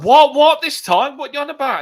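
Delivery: high, loud imitation of a panicking mate; retold panic. Mate answering a morning call from lockup, imitated panic. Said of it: "he was panicking and everything".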